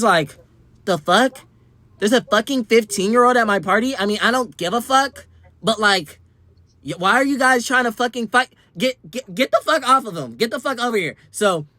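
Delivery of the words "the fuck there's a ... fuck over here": imitating angry host. Party host yelling at the crowd to back off, mock angry yell. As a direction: play her snapping at them to stop fighting.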